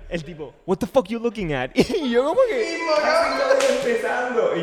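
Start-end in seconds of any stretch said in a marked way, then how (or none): 0.6-1.7 louder, tough-guy street challenge; mock aggressive challenge. Stranger on Hollywood Boulevard confronting them, mock aggressive. 1.7-4.6 higher, slow, frightened little voice; scared squeak. Retold or imitated. Narrator imitating his own scared reply to the stranger, high frightened squeak.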